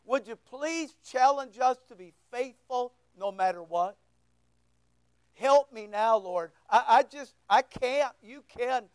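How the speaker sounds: low, pleading prayer voice; earnest prayer. Real, not acted. Preacher asking God to challenge the congregation, earnest prayer. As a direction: pray humbly, slowing into the close.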